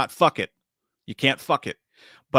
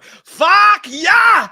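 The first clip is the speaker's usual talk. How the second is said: higher, much louder hammed-up action-hero yell; Antonio Banderas shout; 13 dB louder than usual. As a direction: bellow it like a movie gunslinger, over the top. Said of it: "sounded just like Antonio Banderas in, uh, Desperado".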